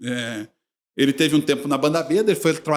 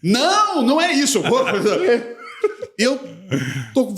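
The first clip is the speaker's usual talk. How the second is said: Jacir's denial; 5 semitones higher than usual. Retold or imitated. Voices Jacir's embarrassed denial.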